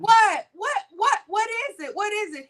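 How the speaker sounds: high, quick bewildered questions; imitating startled concern; retold alarm. Friend startled by boy's crying.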